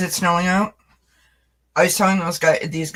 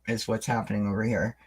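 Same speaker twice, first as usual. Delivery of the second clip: normal talking voice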